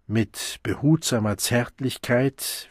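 ordinary delivery

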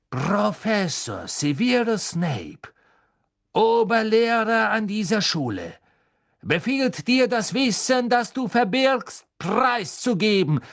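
loud, high, pompous order; imperious command. Acted. Snape loudly ordering the map to give up its secrets. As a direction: thunder out your full title.

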